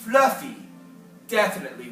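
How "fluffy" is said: plain reading voice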